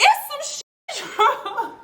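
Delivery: high, drawn-out words through laughter; retold complaint dissolving into laughs; laughing complaint. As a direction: crack up while acting out the complaint. Cracks up at her own complaint.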